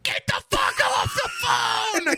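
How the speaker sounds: high, slow hammed-up yell; mock shout. Wife yelling at husband on phone, mock shout. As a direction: scream it like an angry wife. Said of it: "she's like".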